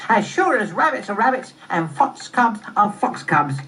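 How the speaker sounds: high, emphatic cub voice; high-pitched, certain fox cub; third fox cub. Acted. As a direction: recite it like a little cub's catchphrase.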